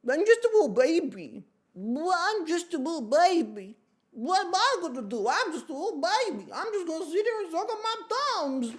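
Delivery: put-on high, loud whiny baby; squeaky put-on baby voice. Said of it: "Don't know why the baby turned into that".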